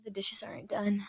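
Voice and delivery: a pointed whisper, imitating mom. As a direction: breathe it out like a hinting mom. Mom's pointed remark about chores.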